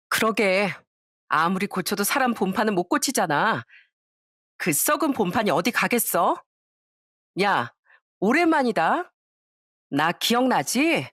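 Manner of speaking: lightly voiced spiteful classmate, slow taunt at the end; a hint of a classmate rubbing it in; second classmate sneering. (살짝 연기한 비웃는 동창)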